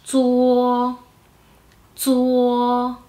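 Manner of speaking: "zuo" with buzzing dz gliding into a held o; Mandarin ZUO. Shown as a demonstration. Teacher modelling Z U O, "zuo" as long level dz-uo. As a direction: draw out "zuo" on one level tone. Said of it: "tenemos Z U O, que sería".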